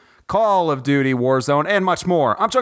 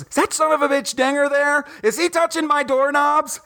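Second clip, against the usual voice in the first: wife's outrage; 9 semitones higher than usual. Retold or imitated. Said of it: "and she goes".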